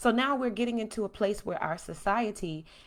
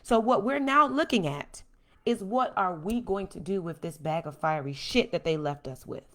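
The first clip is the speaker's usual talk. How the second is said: normal talking voice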